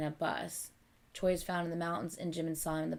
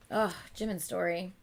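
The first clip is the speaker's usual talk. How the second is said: usual speaking voice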